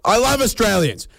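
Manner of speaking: high, mock-adoring outburst; gushing enthusiasm. Imagined newsagent greeting a scratchy buyer, gushing enthusiasm. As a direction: gush it as if thrilled by the customer. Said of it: "maybe we need more enthusiasm".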